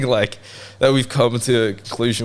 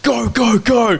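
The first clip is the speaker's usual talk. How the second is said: high loud urgent yells; urgent shouting; 13 semitones higher, 4 dB louder, slower than usual. Retold or imitated. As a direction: yell it to get him moving. Urging chase after thief.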